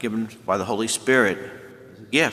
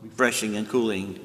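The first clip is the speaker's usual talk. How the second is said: usual speaking voice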